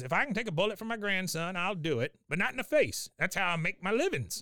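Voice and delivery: put-on high-pitched feisty old man; Cotton. Cotton volunteers to save his grandson, high feisty voice. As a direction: declare it stubbornly, pitched well up. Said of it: "Cotton says".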